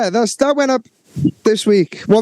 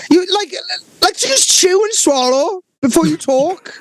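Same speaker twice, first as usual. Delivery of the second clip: mock scolding; 12 semitones higher than usual. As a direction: scold him like a nagging parent. Teasing mate for eating.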